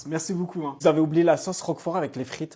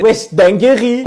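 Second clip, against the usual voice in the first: slow drawn-out exclamation; 4 semitones higher, 10 dB louder, much slower than usual. Retold or imitated. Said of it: "J'étais en mode".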